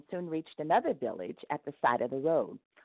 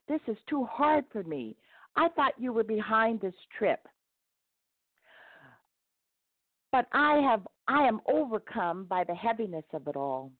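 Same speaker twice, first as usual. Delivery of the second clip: mild wail; 6 semitones higher than usual. A narrator's reading. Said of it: "he wailed".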